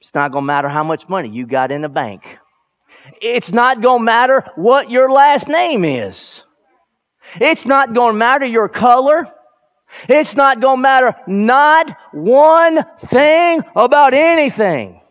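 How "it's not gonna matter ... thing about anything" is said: higher and louder, building to a shouted Yes; full-force preaching. Acted. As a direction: preach it out with fire to the congregation.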